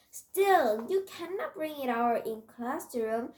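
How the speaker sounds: emphatic telling-off; firm objection. Acted. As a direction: object firmly, hitting the first word hard. Narrator objects to bringing sparrow.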